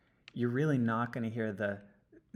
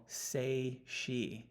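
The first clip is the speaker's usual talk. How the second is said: slow, careful demo of "say she"; slow, drawn-out say she; 2 semitones higher than usual. Shown as a demonstration. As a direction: say she spelled out slowly. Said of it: "right where I'm mousing over".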